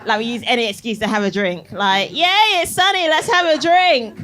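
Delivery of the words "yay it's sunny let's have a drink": normal talking voice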